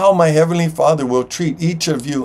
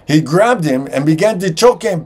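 usual speaking voice

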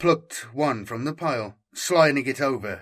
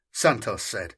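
plain reading voice

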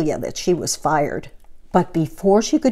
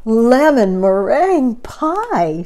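slow, loud delighted cry; delighted exclamation; 5 semitones higher, 5 dB louder, slower than usual. Acted. Missus Rogers tasting the pie, slow delighted cry. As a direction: savour it in delight. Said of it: "she exclaimed".

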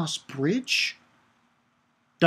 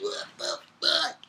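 high, strained disbelief, softer; shocked exclamation; 5 dB quieter than usual. Retold or imitated. Stunned by the dragons merging, a high shocked cry. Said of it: "I was like".

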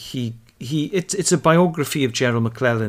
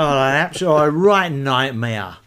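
put-on toddler's Cockney voice; 6 dB louder than usual. Dad imitating his toddler daughter's Cockney. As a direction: play the two-year-old copying her Peckham childminder. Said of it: "She's like".

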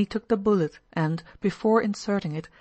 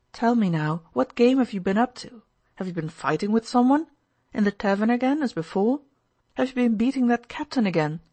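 reproachful; 5 semitones higher, 5 dB louder than usual. A narrator's reading. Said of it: "asked him reproachfully".

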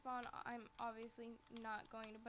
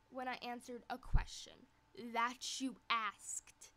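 louder, very slow and pointed; indignant; 9 dB louder than usual. Real, not acted. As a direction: spell it out with indignation. Loud indignant retort.

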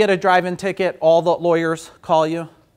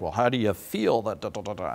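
mimicking interviewer; 8 semitones lower, 9 dB quieter than usual. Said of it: "they're like".

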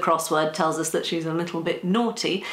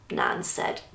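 ordinary delivery

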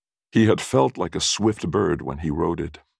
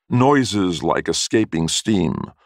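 ordinary delivery

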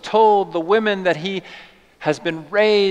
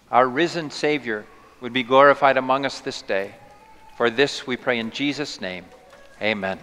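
prayer; 4 semitones lower than usual. Acted. Opening prayer before sermon.

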